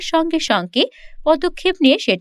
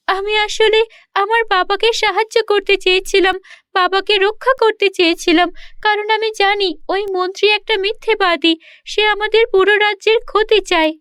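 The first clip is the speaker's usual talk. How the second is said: put-on higher, earnest girlish voice; tearful princess; 6 semitones higher than usual. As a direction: plead her good intent near tears. Princess explains, crying.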